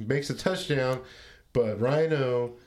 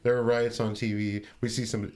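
ordinary talk

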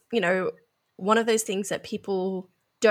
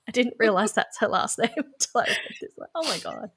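real amused laughter; 2 semitones higher than usual. Real, not acted. Host reacts to a character's surname in her notes, laughing. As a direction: talk through the giggles.

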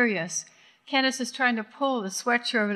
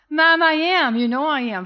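insisting; 6 semitones higher, 6 dB louder than usual. Acted. Daughter insists, shouting back.

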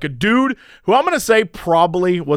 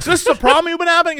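incredulous; 11 semitones higher, much faster than usual. Retold or imitated. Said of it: "And I was like".

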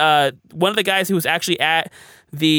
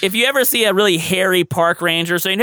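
ordinary delivery

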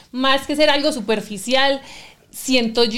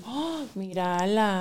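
awed murmur; 3 semitones lower, 8 dB quieter, much slower than usual. Retold or imitated. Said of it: "Y yo".

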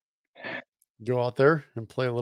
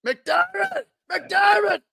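yelling at the coach; much slower than usual. Pretend. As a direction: scream the coach's name across the field.

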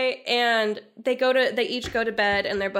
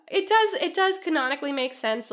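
normal talking voice

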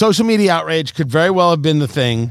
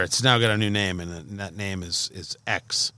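hushed dramatic reveal; 11 semitones lower, 10 dB quieter than usual. Pretend.

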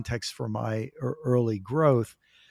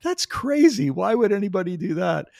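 much higher, louder disbelief; incredulous; 7 semitones higher, 7 dB louder than usual. Retold or imitated. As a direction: react in shocked disbelief. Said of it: "And I just thought".

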